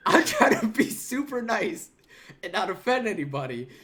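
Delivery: can't hold back the laugh while telling it. Real, not acted. Telling friends about the raid, laughing loudly.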